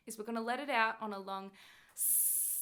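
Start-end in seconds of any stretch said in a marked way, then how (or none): 1.9-2.5 drawn-out s like a snake. Shown as a demonstration. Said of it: "hiss sound like a snake".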